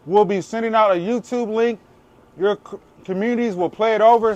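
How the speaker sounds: talking through a mouthful of taco; words round a bite of food; mouth full. Real, not acted. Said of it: "Sorry that my mouth is full".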